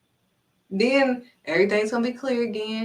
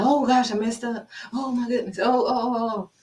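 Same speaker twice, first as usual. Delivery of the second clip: re-enacted panic, raised pitch; freaking out; 3 semitones higher than usual. Speaker mimicking their old panic over messing up, freaking out. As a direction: play up a flustered little freak-out. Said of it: "I would freak out like".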